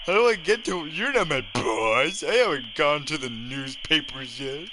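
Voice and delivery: ordinary delivery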